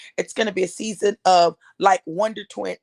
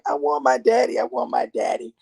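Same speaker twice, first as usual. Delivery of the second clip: retold crying; 5 semitones lower than usual. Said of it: "just saying".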